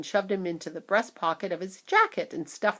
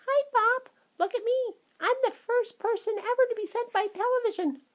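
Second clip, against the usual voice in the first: very high mouse-like squeak; small proud boy voice; tiny squeaky voice; 3 dB louder than usual. A narrator's reading. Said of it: "a tiny little voice".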